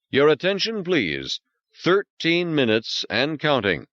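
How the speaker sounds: high, measured computer voice; automated countdown voice; intercom announcement. Acted. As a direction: announce it like a station PA.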